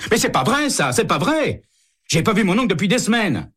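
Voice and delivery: high, fast panicky protest from a cornered man; a rattled suspect squealing his denial; high-pitched flustered denial; nervous suspect. Acted. Fischer protests his innocence.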